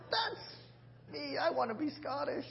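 high, softened cry of longing; a starry-eyed fan's high-pitched wish; excited movie fan. Retold or imitated. Acted reaction to watching Braveheart.

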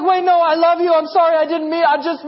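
high, loud, hurried-sounding apology; imitated flustered child. Daughter apologizes for punching dad, acted high and flustered. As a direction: mimic a kid apologizing in a fluster. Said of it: "she's like".

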